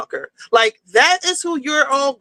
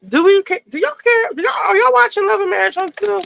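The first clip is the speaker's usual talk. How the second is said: high, fast call to the audience; calling out to viewers; 7 semitones higher, faster than usual. Real, not acted. Asking her viewers if they watch the show, calling out. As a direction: shout the question out to the chat.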